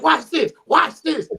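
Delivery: high-pitched eager hype; excited anticipation. Retold or imitated. Comic recalling watching a friend's bit, excited. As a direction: laugh through it, bursting to show someone. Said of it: "in your excitement for stuff".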